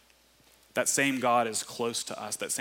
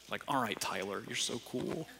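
teasing aside; 2 semitones lower, 10 dB quieter than usual. Real, not acted. Teasing aside about quoted author.

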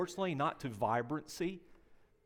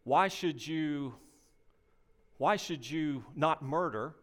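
mock skeptic; much slower than usual. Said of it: "when people are giving that argument".

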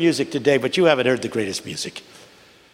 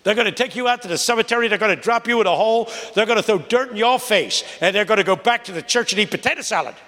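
preacher's warning; 8 semitones higher than usual. Retold or imitated. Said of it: "He said".

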